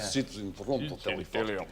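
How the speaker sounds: lightly put-on Russian accent. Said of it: "He said it in a Russian accent".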